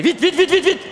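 high, rapid-fire repeated call; urging on. Real, not acted. Coach hurrying players through the exercise, urging on.

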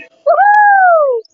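high, loud cheering whoop; victory whoop. Real, not acted. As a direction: whoop at the win. Cheering a death in game.

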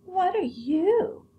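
very high, slow doll voice; a prissy china doll peering down; squeaky high voice of a vain china doll; high-pitched doll. Acted. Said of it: "she said in a high-pitched voice".

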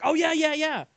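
high, startled yeah yeah yeah; caught off guard. Retold or imitated. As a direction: sound thrown and surprised. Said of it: "And I was like".

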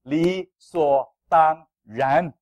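理所当然 dragged out word by word, loud; emphatic slow. Acted. Pastor drives home 理所当然 to the church, slow and loud. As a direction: spell out 理所当然 slowly, each syllable weighted. Pastor hammers key phrase.

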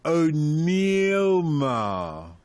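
very slow, stretched-out name; drawn-out pun. Shown as a demonstration. Host reveals a listener's nickname pun, drawn out slowly. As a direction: stretch each syllable right out.

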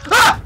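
sudden loud high scream; startled scream. Real, not acted. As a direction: scream at the jumpscare. Jumpscare by dinosaur.

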